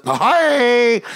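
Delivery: very high, loud comic cry; Jerry Lewis. Retold or imitated. Son gaping at his plate, hammed-up cry. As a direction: squawk it out, hammed up and stretched. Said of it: "like in this Jerry Lewis".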